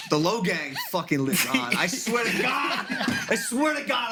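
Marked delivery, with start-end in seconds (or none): 1.7-4.1 high, half-shouted swearing it's true; excited insistence. Real, not acted. Insisting to friends his fandom lives on, high excited shout. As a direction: pitch up and swear it, laughing at yourself.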